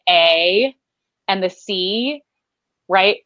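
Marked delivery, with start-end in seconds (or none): 0.1-0.7 A stretched wide and flat in the Midwestern way. Shown as a demonstration. Showing her Midwestern vowel, wide A. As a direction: spread the A wide. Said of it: "so it's really wide".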